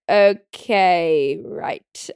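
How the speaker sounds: drawn-out okay, dropping to a voiceless right; hesitant mock reply. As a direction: trail off into a whisper, imitating someone unsure. Officer's doubtful reply, retold.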